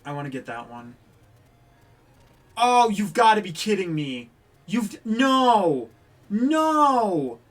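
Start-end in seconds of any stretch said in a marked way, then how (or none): 2.6-4.3 loud, high outburst of disbelief. Real, not acted. Loses at the game, reacts in disbelief. 4.7-7.4 high, loud drawn-out shout; anguished shout. Real, not acted. Loses at the game, protests.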